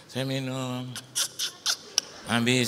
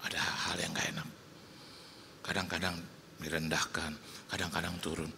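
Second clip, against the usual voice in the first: lower, softer and sincere; soft closing word; 8 semitones lower, 10 dB quieter than usual. Real, not acted. Preacher closing the sermon to the congregation, soft and sincere. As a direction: draw them in gently with the lesson. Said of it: "preacher softening for closing word".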